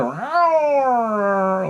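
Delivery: long drawn-out high cat howl; cat yowl. Retold or imitated. Retelling a monster movie's sudden noise from the pool, imitated cat roar. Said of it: "like a cat sound".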